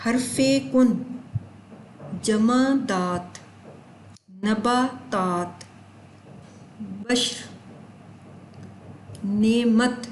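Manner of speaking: each word drawn out slowly for dictation. Shown as a demonstration. Teacher dictating words to students, slow and clear. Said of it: "میں لفظوں کو ادا کر رہی ہوں".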